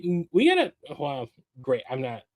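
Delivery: ordinary talk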